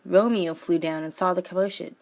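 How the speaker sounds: normal talking voice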